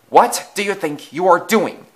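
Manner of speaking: loud, high-pitched outraged demand; angry bellow. A narrator's reading. Thomas confronting the engine taking his train, angry bellow. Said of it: "bellowed Thomas".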